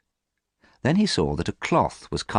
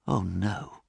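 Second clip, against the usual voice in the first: a soft, hushed "oh, no"; quietly; 6 dB quieter than usual. A narrator's reading. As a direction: let it fall away softly, dismayed. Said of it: "said Basil quietly".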